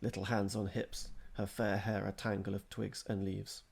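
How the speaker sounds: usual speaking voice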